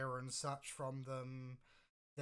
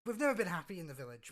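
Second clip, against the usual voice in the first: confident villager pleading; 4 semitones higher, 7 dB louder, faster than usual. Acted. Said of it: "step forward at this point and say".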